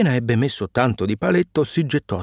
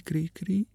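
faint, soft last cri cri; dying chirp; 5 semitones higher, 7 dB quieter than usual. A narrator's reading. Dying cricket's last faint chirp after being struck. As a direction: breathe out the final chirp. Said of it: "ebbe appena il fiato di fare".

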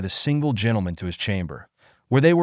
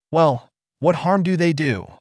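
higher-pitched, mild retort; 7 semitones higher than usual. A narrator's reading. Sally challenging Brass's complaint, mild retort. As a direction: answer back with a touch of challenge. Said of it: "retorted Sally".

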